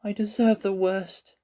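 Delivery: put-on high, hopeless boy voice; a boy who gives up; despairing boy. A narrator's reading.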